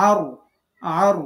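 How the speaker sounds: আর with a full, rounded final r; heavy r. Shown as a demonstration. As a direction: round the lips on the r of আর. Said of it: "ঠোঁটটাকে একটু গোল করবেন".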